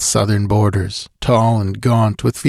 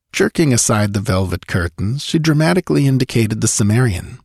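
plain reading voice

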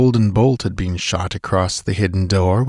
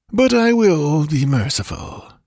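magnanimous; 7 semitones higher than usual. A narrator's reading. Priest relents after listing grievances, falsely magnanimous.

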